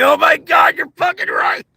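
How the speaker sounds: very high, squealing amused shout; hysterical realization. Retold or imitated. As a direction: shriek it through amusement. Hysterical reaction to the joke.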